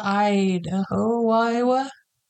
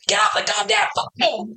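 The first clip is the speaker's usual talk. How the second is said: much higher, yelled in a put-on gruff dad voice; angry dad shout; 11 semitones higher than usual. Dad yelling at daughter on landline, furious shout. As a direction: bellow it like a fed-up father. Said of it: "I don't know why my dad sounds like that".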